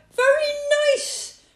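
high, very loud rabbit yell. A narrator's reading. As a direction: yell it for someone hard of hearing. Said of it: "yelled Rabbit".

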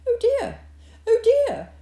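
high, loud little hedgehog wail; fretful hedgehog. A narrator's reading. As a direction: fret aloud as a lost little hedgehog. Lost hedgehog frets in snow.

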